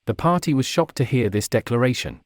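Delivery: ordinary delivery, nothing marked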